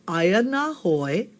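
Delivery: Aya na hoi drawn out very slowly; slow model phrase. Shown as a demonstration. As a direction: stretch Aya na hoi syllable by syllable. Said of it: "let's try it one more time".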